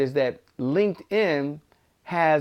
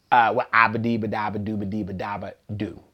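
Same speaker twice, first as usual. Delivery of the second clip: low monotone run of nonsense syllables; mock waffling in a low drone; evasive babble; 6 semitones lower than usual. Mimicking an evasive answer with nonsense.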